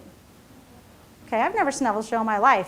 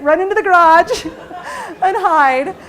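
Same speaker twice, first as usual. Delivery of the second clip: laughing; 6 semitones higher, 6 dB louder, slower than usual. Real, not acted. Husband hiding from cars, laughing.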